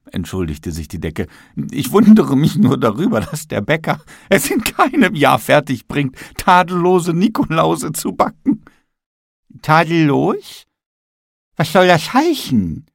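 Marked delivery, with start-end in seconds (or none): 1.7-8.6 high, light voice for the talking ceiling; high mild apology from the ceiling; apologetic ceiling. Acted. 9.6-12.9 high, slow, offended Nikolaus; high, drawn-out huffy gingerbread man; indignant question. Acted. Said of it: "fragte der Nikolaus und rollte seine schwarzen Korinthenaugen".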